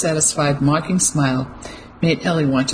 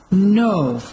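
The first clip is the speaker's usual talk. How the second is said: anguished cry; 5 semitones higher than usual. A narrator's reading. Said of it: "an anguished cry".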